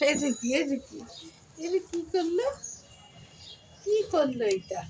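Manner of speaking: high, delighted what-did-it-do; amused surprise. Real, not acted. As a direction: ask in amazed delight. Amused questions to the cat. (অবাক হয়ে খুশিতে প্রশ্ন)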